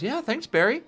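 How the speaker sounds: put-on high, young girl's voice; little girl voice. Young Iris to Barry who helped her up, girlish. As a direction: pitch it up like a schoolgirl.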